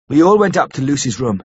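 plain reading voice

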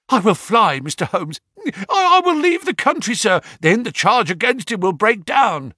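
high, frantic begging; frightened pleading. Acted. Ryder begs Holmes to let him flee, high and frantic.

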